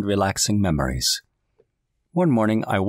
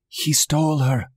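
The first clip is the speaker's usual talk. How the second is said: acted panic; 8 semitones higher than usual. A narrator's reading. Women report the abduction in panic.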